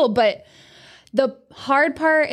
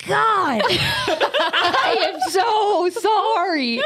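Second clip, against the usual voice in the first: higher, very slow, laughing "so sorry"; mock pity; 7 semitones higher, much slower than usual. Mocking people who over-apologize about her wedding. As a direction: ham up the over-the-top sympathy while laughing. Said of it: "I hate when people really are like".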